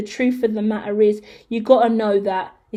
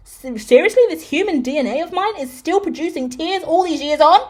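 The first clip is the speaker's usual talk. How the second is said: hammed-up, higher-pitched despairing lament; exasperated crier; 6 semitones higher, 3 dB louder than usual. Crier begs someone to stop these tears, mock despairing. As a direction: play melodramatic despair at your own tears. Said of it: "it's so irritating to be a crier".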